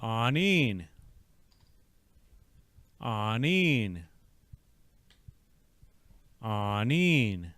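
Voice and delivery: "Anin" said ah-neen, falling; "Anin" with stress on neen; whole word drill. Shown as a demonstration. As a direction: model "Anin" for the learner to repeat. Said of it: "Now, the whole word".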